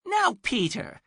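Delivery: put-on high, scolding old woman; muffled old lady. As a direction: call through the door like a fussy grandma. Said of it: "says a muffled voice".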